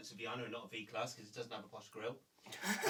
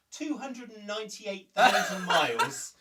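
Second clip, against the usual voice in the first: laughing; 6 semitones higher, 11 dB louder than usual. Real, not acted. Revealing absurd mileage.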